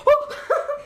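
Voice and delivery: high, laughing "oh!"; startled yelp. Retold or imitated. Kids caught peeking, high startled laughing yelp. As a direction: yelp like you've been caught.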